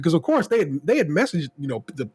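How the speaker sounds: ordinary delivery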